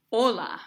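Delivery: "Hola" with stress on the first syllable; "Hola" accented on O, falling away after. Shown as a demonstration. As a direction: lean on the O of "Hola". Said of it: "'cause there's an accent".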